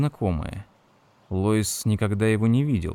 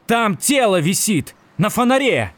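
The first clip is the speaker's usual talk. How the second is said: higher, louder yell; 11 semitones higher, 5 dB louder than usual. A narrator's reading. Ed yells at Margaret about the body, loud and high. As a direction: yell it out in frustration. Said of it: "заорал Эд".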